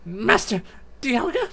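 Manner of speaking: very high-pitched minion cry; alarmed Sableye. Acted. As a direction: call out to your master in a high voice. Sableye calls its master.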